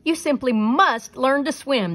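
loud, high sea-creature bellow; big, emphatic reproach. A narrator's reading. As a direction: bellow a scolding as a storybook creature. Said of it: "the sea creature bellowed".

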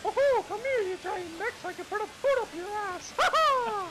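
high squeaky cartoon mouse; Mickey Mouse voice. Shown as a demonstration. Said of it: "Here's my Mickey Mouse impression".

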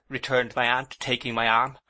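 plain reading voice

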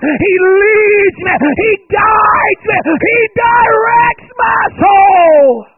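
loud, high, slow shouted preaching climax; full-force preaching. Real, not acted. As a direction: preach it to the rafters, drawing out each phrase.

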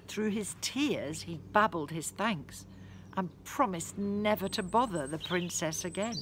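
usual speaking voice

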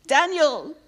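high, loud call down into the den. Acted. King calling to Daniel in the den, loud and anxious. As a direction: shout for Daniel, hoping.

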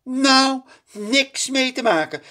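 high, loud stern brush-off. A narrator's reading. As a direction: princess cutting off the servant. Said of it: "zegt prinses Titia streng". (streng en hoog)

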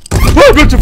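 sudden terrified shriek, high and loud; startled scream. Real, not acted. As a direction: yelp in real fright. Jump scare in horror game.

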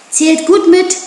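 high, loud taunt; mock them from a distance; scornful shout, pitched well up; jeering call. A narrator's reading. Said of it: "rief Mowgli höhnisch".

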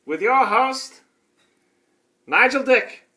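high, theatrical host introduction; show announcer. Pretend.

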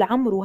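عمرو said as "amru"; mispronounced final waw. Shown as a demonstration. Teacher naming a faulty reading, عمرو as amru. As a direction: add an oo to the end of عمرو. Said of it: "هذه قراءة خاطئة".

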